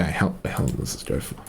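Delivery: breathy whispered question; whispering. Real, not acted. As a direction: whisper it to yourself. Unvoiced muttered question.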